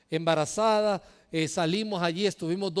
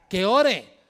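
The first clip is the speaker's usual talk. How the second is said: "Que ore" louder, punched answer; enfático; 7 dB louder than usual. Acted. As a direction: drive the point home loud. Repeats scripture answer emphatically. ("Que ore" más fuerte, remarcado)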